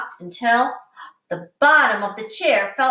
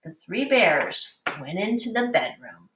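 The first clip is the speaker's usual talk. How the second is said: ordinary delivery, nothing marked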